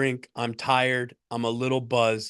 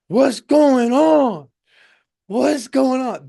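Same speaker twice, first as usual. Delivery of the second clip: high, loud, drawn-out mock shout; afraid and angry; 11 semitones higher, 9 dB louder, slower than usual. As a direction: imitate a scared, angry passenger hollering. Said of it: "He's just clearly afraid. So when you're that afraid, anger comes in".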